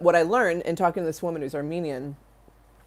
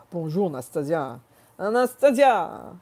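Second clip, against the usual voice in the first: put-on French accent. Imitating French people greeting her, growing French accent. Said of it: "like the French said when I lived there".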